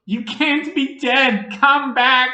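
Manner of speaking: high, loud, slow pleading cry; desperate cry. Acted. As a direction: beg the dead to come back, at full voice. Son pleading over dead father.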